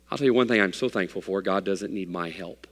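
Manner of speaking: ordinary talk, nothing marked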